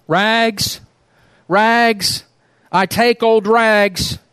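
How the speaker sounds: bleeding rag man still calling his wares; sobbing cry. Acted. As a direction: call out through the pain, still ringing. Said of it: "cried the sobbing".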